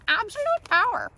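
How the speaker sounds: high, loud hammed-up proclamation; mock power cry. Speaker jokes about having absolute power, mock power cry. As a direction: belt it out, playing power-mad.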